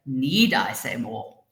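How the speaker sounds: "Need" drawn out and stressed, the rest falling low. Shown as a demonstration. Teacher showing how stress changes meaning, stress on "Need". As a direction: slowly lean on "Need". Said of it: "underlining different words".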